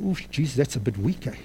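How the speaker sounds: quiet aside to Jesus. Retold or imitated. Preacher retelling his doubtful prayer, soft and quiet. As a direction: let it drop to a private grumble. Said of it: "I said".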